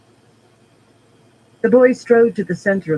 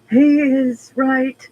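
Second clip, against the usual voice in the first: unsteady voice; 9 semitones higher, 6 dB louder, much slower than usual. A narrator's reading. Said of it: "Eledrin's voice was unsteady".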